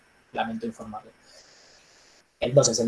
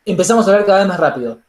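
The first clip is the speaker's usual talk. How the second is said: fast nervous talk; 5 semitones higher, 5 dB louder, faster than usual. Shown as a demonstration. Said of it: "talking faster when nervous".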